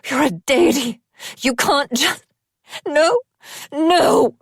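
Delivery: screaming denial at her dying friend. A narrator's reading. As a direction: scream in disbelief. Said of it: "screamed it at him".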